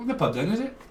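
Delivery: put-on a second, milder go at a Welsh accent; light mock Welsh lilt on the question. Reader retrying the Welsh line, mild Welsh accent. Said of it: "not very good at the old Welsh accent".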